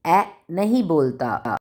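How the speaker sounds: usual speaking voice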